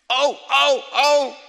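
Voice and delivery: loud high cries of pain; acted cries of pain, high and loud. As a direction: yelp out the pain of the nails. Preacher voicing Jesus in pain.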